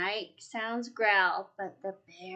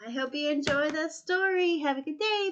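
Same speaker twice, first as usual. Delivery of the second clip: cheerful goodbye; 6 semitones higher, 3 dB louder, faster than usual. Real, not acted. Cheerful sign-off.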